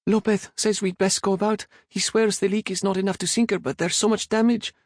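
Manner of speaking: put-on high, anxious boy's voice; nervous cabin boy blurting bad news; frightened stammer. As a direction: cabin boy reporting damage to his captain, scared. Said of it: "Enrique stammered".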